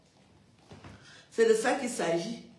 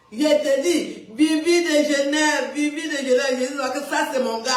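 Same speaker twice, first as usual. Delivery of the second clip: excited shout; 6 semitones higher, 7 dB louder than usual. Real, not acted. On the phone to a friend, loud laughing excitement. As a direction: call out his name with delight.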